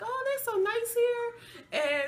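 high, gushing quote voice; high-pitched gushing. Retold or imitated. Two patients praising the kind staff, high-pitched gushing. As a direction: pitch it way up, delighted by the kindness.

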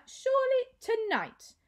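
high, loud haughty voice; a snobbish lady overruling the girl; haughty matron. A narrator's reading. Mother refusing Maya's plea, high and haughty.

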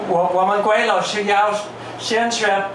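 ordinary delivery

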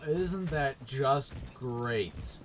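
slow, heavily stretched sarcasm; bitter sarcasm. Real, not acted. Player who must run through it all, bitter sarcasm. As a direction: draw out the fake delight. Sarcasm after unhappy death.

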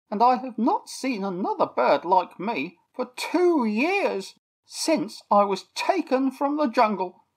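a chatty toucan telling his tale; toucan Terry, chatty. A narrator's reading.

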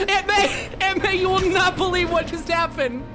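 high-pitched excited announcement; breathless news. Acted. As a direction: burst out with unbelievable news, way up high. Rushing news to Aunt May.

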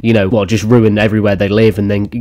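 ordinary talk

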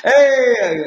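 a big, high, loud delighted shout; light up at the surprise; excited hey. Real, not acted. Guest greeting the South African host, delighted shout.

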